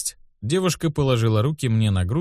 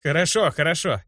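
put-on higher-pitched boy giving in; conceding; 6 semitones higher than usual. A narrator's reading. Boy gives in to the healer, conceding. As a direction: surrender the argument. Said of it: "поднимая руки вверх и признавая капитуляцию".

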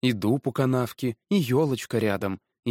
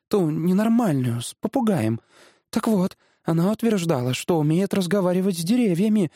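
higher-pitched, wondering chatter; amazed wife; 7 semitones higher than usual. Acted. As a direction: think aloud with growing amazement. (изумлённый женский голос)

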